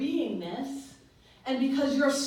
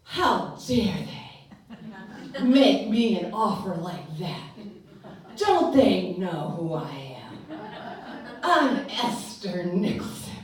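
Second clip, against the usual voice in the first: slow, lofty, a touch of hammed-up pride; mock indignation; 3 semitones lower, 5 dB louder, slower than usual. Preacher acting her offended ego, mock haughty. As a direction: play the offended diva, slowly. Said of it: "So then I was like".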